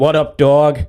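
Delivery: a hint of a northeast English accent, not far from his own; mock northeast accent. Podcast host tries his northeast English accent for his guest, lightly. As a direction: toss off a half-hearted northeast English greeting. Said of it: "my, uh, north, northeast accent".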